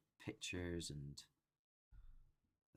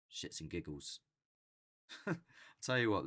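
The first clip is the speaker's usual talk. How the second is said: ordinary talk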